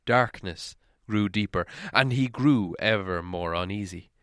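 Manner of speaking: usual speaking voice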